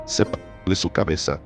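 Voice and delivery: ordinary delivery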